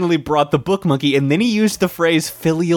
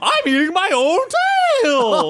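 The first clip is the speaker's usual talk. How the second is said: high, drawn-out silly creature voice; hammed-up spirit snake; 12 semitones higher, slower than usual. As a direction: wail it like a goofy spectral snake. Voicing the spirit snake in a retelling.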